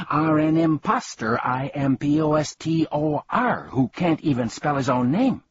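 excited shout. Acted. Bee excitedly calling the humbug an impostor. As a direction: spell it out in a fury. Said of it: "shouted the bee very excitedly".

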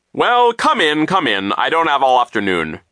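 loud, high, imperious character voice; impatient vice principal. A narrator's reading. Vice principal hurrying children into his office, loud and impatient. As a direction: start high and shrill, order them in loudly.

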